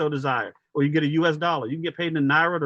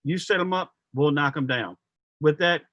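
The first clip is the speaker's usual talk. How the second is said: usual speaking voice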